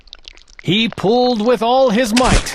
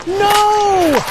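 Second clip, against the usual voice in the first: shout; 12 semitones higher than usual. Acted. Bartholomew stops the trumpeter from touching it, shout. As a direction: stop him with one cry. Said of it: "shouted Bartholomew".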